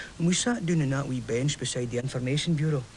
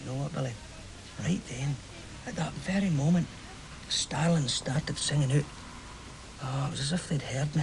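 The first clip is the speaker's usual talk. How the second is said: normal talking voice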